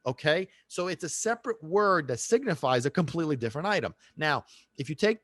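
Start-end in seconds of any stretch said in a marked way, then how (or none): none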